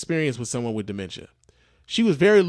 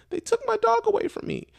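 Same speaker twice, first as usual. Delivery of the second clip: much higher, put-on old lady voice; mimicking the old lady. Old lady telling the worker her dog was taken, high mock voice. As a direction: squeak it out like a sad old woman.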